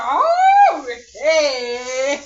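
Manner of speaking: high, loud delighted oh breaking into a laugh; laughing oh okay. Retold or imitated. Retelling her own delighted reply, loud and laughing. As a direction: shout it, then laugh it off. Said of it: "I said".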